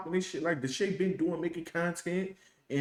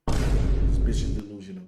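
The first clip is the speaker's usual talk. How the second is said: louder, punched insult; loud emphatic repeat; 5 semitones lower, 7 dB louder than usual. Real, not acted. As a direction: hammer the insult home.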